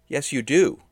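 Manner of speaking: emphatic contradiction; insistent. A narrator's reading. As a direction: push back firmly and loudly. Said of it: "Lee insisted".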